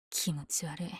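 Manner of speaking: soft, grossed-out whisper; disgusted whisper. Acted. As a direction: whisper it with distaste. Disgusted at criminals.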